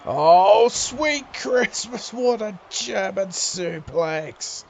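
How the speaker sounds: loud, high, stretched-out cry of awe; excited exclamation. Real, not acted. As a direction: let the amazement ring out slowly. Excited exclamation at big move.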